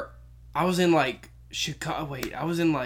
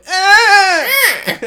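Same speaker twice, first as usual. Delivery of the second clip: high, loud imitated cry; mock falling wail; 13 semitones higher, 10 dB louder than usual. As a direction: wail as if tumbling over. Imitating the grandmother's fall.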